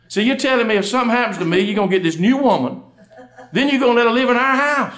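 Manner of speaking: high-pitched, wounded wife's questioning; hammed-up upset. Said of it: "She went from sad to mad".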